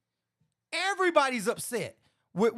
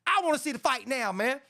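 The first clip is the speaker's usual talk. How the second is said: ordinary talk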